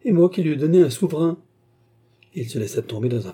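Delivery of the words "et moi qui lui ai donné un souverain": higher, louder cry of dismay; appalled outburst; dismayed exclamation. A narrator's reading. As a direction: blurt it out, mortified, voice lifted. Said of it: "s'écria Yogi".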